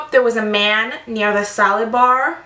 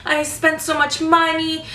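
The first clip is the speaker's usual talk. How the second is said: higher, put-on sorry voice; imitating the customer's hollow apology; mock fake apology; 5 semitones higher than usual. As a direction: play her insincere apology a bit higher. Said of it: "She's like".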